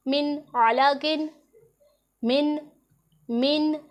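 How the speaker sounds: slow मिन आलाकिन with a full नून; clear nun. Shown as a demonstration. Teacher drilling the clear nun for her students. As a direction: say मिन crisply, not म्यों. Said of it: "म्यों नहीं पढ़ना".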